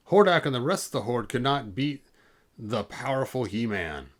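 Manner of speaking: ordinary delivery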